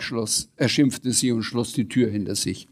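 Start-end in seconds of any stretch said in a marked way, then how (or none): none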